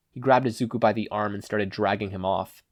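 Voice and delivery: plain reading voice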